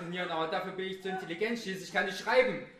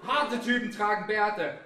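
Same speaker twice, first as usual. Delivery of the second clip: macho slogan; 5 semitones higher, 8 dB louder, slower than usual. Acted.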